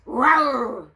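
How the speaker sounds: loud mock dragon growl. Dragon growling at the princess, loud mock growl. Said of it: "growled the Little Green Dragon".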